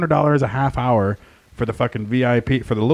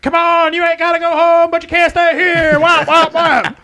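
much higher, louder hammed-up holler; closing-time bellow; 8 dB louder than usual. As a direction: rouse the drunks and herd them out. Waking drunks at closing time.

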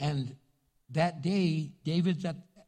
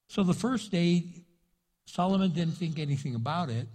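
ordinary delivery

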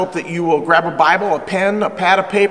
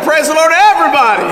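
louder, quicker call to praise; louder repeat; 9 semitones higher, 6 dB louder, faster than usual. Real, not acted. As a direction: push it out so the room answers. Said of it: "I said".

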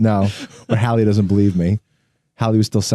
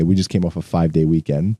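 normal talking voice

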